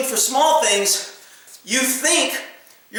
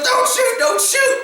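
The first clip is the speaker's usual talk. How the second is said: frightened plea; 14 semitones higher, slower than usual. Retold or imitated. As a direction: plead for your life in a high voice. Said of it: "he goes".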